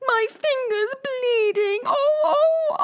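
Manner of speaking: high, slow wailing scream; screaming queen. Acted. Queen shrieks about her bleeding finger, high wailing. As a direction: shriek it like a steam whistle. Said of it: "the Queen began screaming so loud".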